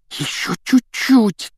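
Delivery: very high, slow strained plea; strained gasp. A narrator's reading. Ron urges the car on over the lake, strained gasp. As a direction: squeeze it out slowly, high in the voice. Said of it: "выдохнул Рон".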